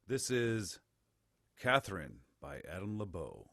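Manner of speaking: low, soft radio announcer delivery. Real, not acted. Host announcing a song for listeners, radio voice. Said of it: "I like your radio voice there".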